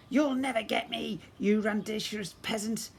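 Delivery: high, sneering taunt from a fleeing villain; mock the hero as you run off; sneering goblin prince.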